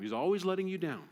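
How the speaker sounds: ordinary delivery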